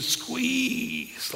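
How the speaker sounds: "squeeze" stretched long on the vowel; drawn-out SQUEEZE. Shown as a demonstration. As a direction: drag out "squeeze" as if wringing it. Draws out the word being defined.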